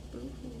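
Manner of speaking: soft whimpering boo-hoo; tiny hammed-up boo-hoo; mock sob. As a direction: give a little pretend crybaby sob. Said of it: "blubbers on".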